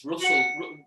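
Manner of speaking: ordinary talk, nothing marked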